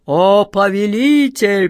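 slow, booming genie voice; thundering genie. A narrator's reading. Huge genie awaiting his master's command, slow and thundering. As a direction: boom it out slowly, each vowel drawn long. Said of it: "прогремел он".

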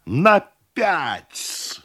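high, drawn-out announcement; exclaiming. A narrator's reading. Host announces the tax to cousin, exclaiming. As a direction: cry the figure out, then let it fall. Host snaps back the sum.

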